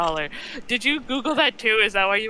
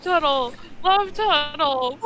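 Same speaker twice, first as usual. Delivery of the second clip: amused echo; 7 semitones higher, slower than usual. Real, not acted. Listener echoes absurd insult, cracking up.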